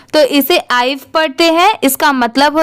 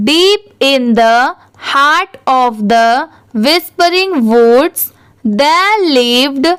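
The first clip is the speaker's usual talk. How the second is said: very slow, word-by-word reading; slow model reading; much slower than usual. Shown as a demonstration. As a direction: read slowly for learners to follow, pausing between words. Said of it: "थोड़ा धीरे धीरे पढ़ूंगी".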